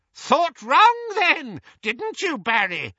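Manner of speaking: put-on very high, triumphant old woman; piercing elderly triumph; delighted old aunt. A narrator's reading. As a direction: crow shrilly at catching him out. Muriel delighted at the effect.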